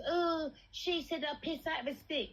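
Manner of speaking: mocking quote. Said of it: "Like, Barrel Cakes".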